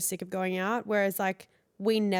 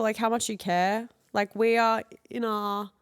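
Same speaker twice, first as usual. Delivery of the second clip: ordinary delivery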